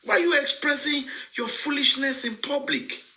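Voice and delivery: very high-pitched, incredulous exasperation; exasperated scolding. Real, not acted. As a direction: scold in a high voice, holding back words. Scolding critics, holding back words.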